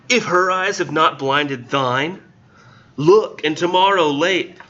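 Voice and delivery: high, slow, theatrical poetry recital; lofty slow recital, pitched high; weird dramatic reading voice. Acted. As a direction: declaim it like an old stage actor. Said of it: "read this with this weird voice".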